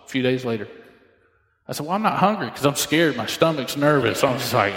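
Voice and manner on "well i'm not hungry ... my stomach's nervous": normal talking voice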